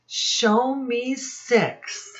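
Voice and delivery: ordinary talk, nothing marked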